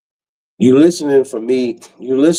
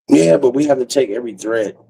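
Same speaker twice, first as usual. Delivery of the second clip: normal talking voice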